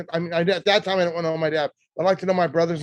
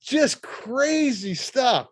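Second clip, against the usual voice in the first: slow words through laughter; laughing; 5 semitones higher, much slower than usual. Real, not acted. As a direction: still chuckling, drawing it out. Still laughing after the story.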